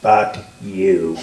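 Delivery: low mock contempt; hateful. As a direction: imitate a scowling curse, dropped low. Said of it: "this very hateful scowl".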